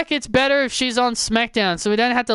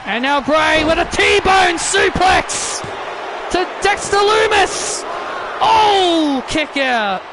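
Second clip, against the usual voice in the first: excited wrestling call, pitch climbing as the finish nears; urgent play-by-play; 5 semitones higher than usual. Acted. Commentator sensing a new champion, urgent play-by-play. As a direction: build to a high, shouted near-fall call. Calls a near finish live.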